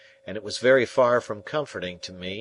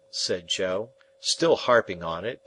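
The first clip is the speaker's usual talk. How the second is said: ordinary delivery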